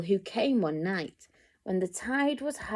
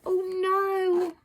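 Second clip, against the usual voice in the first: high worried gasp of oh no; alarmed oh no; 9 semitones higher than usual. A narrator's reading. Reader gasping as the whale nears shore, high. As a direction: sound the alarm for the child. Alarm while reading to child.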